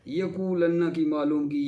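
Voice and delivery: ordinary talk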